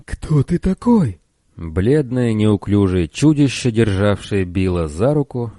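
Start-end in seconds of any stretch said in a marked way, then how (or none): 0.0-1.2 high slow frightened squeal; shrieking. Acted. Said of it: "взвизгнул".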